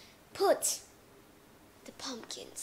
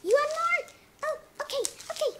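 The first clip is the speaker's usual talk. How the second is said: homeowner giving candy; 13 semitones higher, 6 dB louder than usual. Acted. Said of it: "Give to them".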